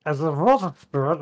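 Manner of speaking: brief put-on Eastern European accent. Host retries the reading in a mock Eastern European accent. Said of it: "I'll do it in a, uh, thing of".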